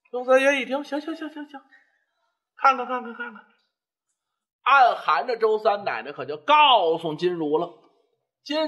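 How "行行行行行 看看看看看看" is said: repeated words tumbling out in quick agreement; hasty giving in. Acted. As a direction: give in, rattling off the same word. 周三爷被劝服，连声答应. (连声应承，急忙让步)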